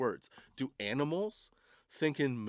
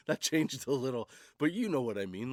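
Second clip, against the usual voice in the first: usual speaking voice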